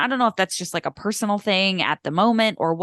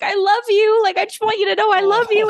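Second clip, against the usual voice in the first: much higher, louder, rushed; gushing affection; 11 semitones higher, 5 dB louder, faster than usual. Retold or imitated. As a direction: pour out love to a little brother. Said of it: "texted him and was like".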